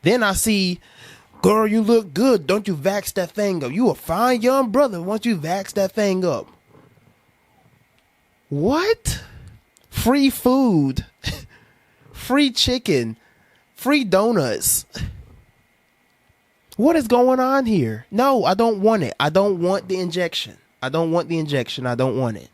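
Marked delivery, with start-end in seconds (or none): 1.4-6.5 higher, hyped-up flattering pitch; hammed-up sweet-talking ad; mock promo voice. Mocking vax promo voice. 8.5-14.9 high, slow, drawn-out free offers; hammed-up wonder at freebies; mock amazement. Mocking incentive offers. 16.8-22.5 rising-then-settling refusal; emphatic refusal. Real, not acted. Refusing the injection.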